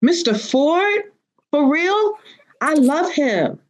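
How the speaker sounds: high, slow mock-smitten gushing; swooning schoolgirl. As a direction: imitate a girl gushing over her teacher. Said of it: "I was like".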